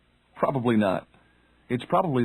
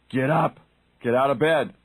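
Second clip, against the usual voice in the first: firm, louder command to get up; louder inner voice; 4 dB louder than usual. Retold or imitated. Inner voice nagging you out of bed, louder. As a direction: bark the wake-up order a notch louder. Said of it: "your internal voice gets louder and louder".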